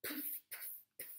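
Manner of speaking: voiceless puffing like a steam pump; breathy engine puffs. A narrator's reading. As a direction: push air out in puffs.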